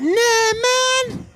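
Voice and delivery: high, loud "Nah, man" of disbelief; incredulous. Retold or imitated. Comedian reacting to sleepwalking neighbours, high and incredulous. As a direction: refuse in comic alarm. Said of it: "I'm like".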